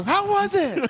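squeaky, eager mother voice; excited high mom voice. Pretend. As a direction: chirp it like a nosy mom. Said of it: "immediately call her".

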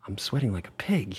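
imitating a Frenchman's accent; lightly put-on French accent. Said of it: "but in a beautiful French accent".

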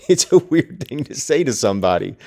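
high laugh spilling into speech; laughing in disbelief at the book; amused laugh. Real, not acted. Reader laughing at Heathcliff's self-pity, amused high laugh.

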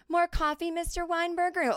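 high, bright-eyed mock-innocent offer; lightly mocking her younger self serving coffee; sweet waitress voice. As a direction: play the oblivious waitress sweetly. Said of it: "And I go".

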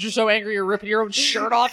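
normal talking voice

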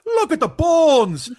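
high, loud, slow mock wail; dramatic shout. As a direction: howl it like a tortured soul. Mock anguished outburst.

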